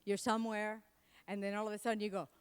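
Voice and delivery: usual speaking voice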